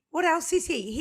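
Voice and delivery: high, loud mock amazement; mock-astonished repeat; excited repeat. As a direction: pitch it up, playfully amazed. Teasing cohost, repeated higher.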